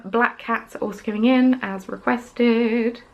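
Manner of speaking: normal talking voice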